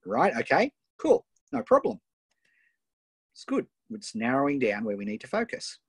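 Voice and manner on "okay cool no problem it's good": upbeat. Real, not acted. Coach reassures, pitch jumps.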